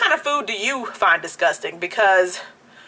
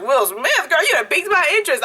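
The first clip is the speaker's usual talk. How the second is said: quick, higher, eager gossip; excited interest; 6 semitones higher than usual. Retold or imitated. Retelling her nosy reaction to salon gossip, eager and quick. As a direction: perk up fast, higher and hungry for the news. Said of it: "But I'm like".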